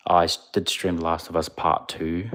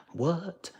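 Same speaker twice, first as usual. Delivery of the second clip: surprised; 11 semitones higher, 6 dB quieter than usual. Pretend. Reacting to an accent ranking.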